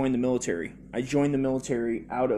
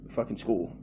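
ordinary delivery

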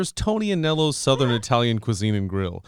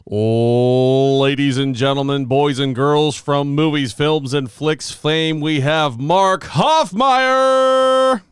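showman announcer intro; 4 dB louder, much slower than usual. Pretend. Host introducing Mark like a boxing ring announcer.

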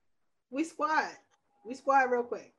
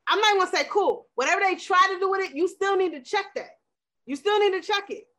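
impassioned; 7 semitones higher than usual. Real, not acted. Impassioned rant, higher, fast.

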